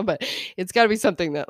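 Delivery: ordinary talk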